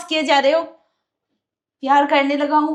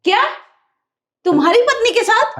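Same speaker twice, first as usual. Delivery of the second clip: shocked; 7 semitones higher than usual. Acted. Wife shocked that her husband is with his wife. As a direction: throw it back in disbelief.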